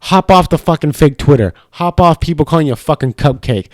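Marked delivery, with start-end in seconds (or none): none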